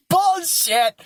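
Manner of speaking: high, drawn-out scoffing call; mock disbelief. Calling out a friend's denial, mock disbelief. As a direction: pitch it way up in playful disbelief.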